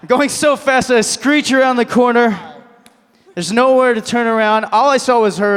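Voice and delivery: high, loud, breathless-style story peak; dramatic climax. Acted. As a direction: project the disaster at full pitch. Climax of the acted car story.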